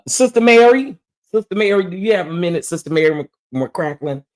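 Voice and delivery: high, loud summoning call for Sister Mary. Acted. Streamer calling Sister Mary into the room, high loud holler. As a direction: raise the voice high, hollering for her to come.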